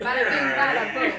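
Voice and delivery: very loud New York mobster voice. Shown as a demonstration. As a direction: bellow it like a cartoon mobster. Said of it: "a bad mobster voice".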